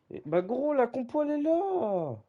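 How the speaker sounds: high, loud excited cry; excited shout. Real, not acted. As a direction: shout out the reveal. Excited reveal of lineup.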